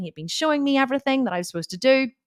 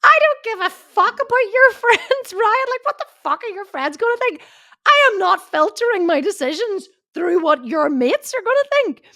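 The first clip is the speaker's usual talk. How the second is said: much higher and louder, swearing in a heated shout; retold furious outburst; 12 semitones higher, 5 dB louder than usual. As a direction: let rip at him, indignant and loud. Her furious retort, retold argument.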